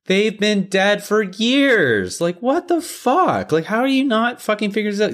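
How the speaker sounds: high, incredulous rant; exasperated disbelief. Real, not acted. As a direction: argue it in high-pitched exasperation.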